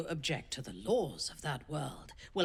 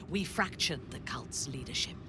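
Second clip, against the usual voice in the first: normal talking voice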